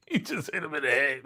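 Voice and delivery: high, quick, cracking up while speaking; amused, laughing commentary. Real, not acted. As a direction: talk through real laughter. Cracks up at the picture.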